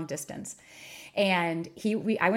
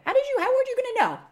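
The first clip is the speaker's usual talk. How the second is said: much higher and fast, in disbelief; incredulous; 5 dB louder, faster than usual. Real, not acted. To listeners, a very high, fast disbelieving cry. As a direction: throw it up in exasperated disbelief. Rhetorical disbelief at dating luck.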